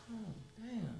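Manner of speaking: very soft, barely voiced echo; hushed, retold private reaction; under the breath. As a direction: repeat it quietly to yourself. His own muted reaction, retold.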